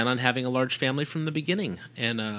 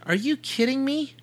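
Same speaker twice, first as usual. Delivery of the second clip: incredulous; 12 semitones higher than usual. Real, not acted. Incredulous reply to question.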